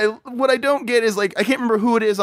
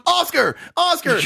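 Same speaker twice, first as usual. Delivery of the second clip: higher, calling a name in alarm; mock calling out; 10 semitones higher than usual. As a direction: shout the name twice like a worried friend. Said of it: "he's going".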